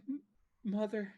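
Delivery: small, high, faltering voice; withered daughter. Acted. Withered Sharwin looks up at her mother, weak high voice. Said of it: "who is very withered at this point".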